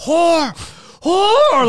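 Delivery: furious shouted insult; enraged yell into the night. Retold or imitated. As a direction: scream it after her from the car. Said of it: "he starts yelling in the car".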